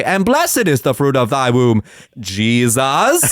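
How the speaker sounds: droning rosary line with "blessed" punched and "Jesus" stretched; wrong emphasis. Retold or imitated. Jet-lagged grandson imitating the rosary woman, wrong emphasis. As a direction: slowly intone it, stressing "blessed" oddly, "Jesus" set apart. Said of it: "I'm hearing this woman say".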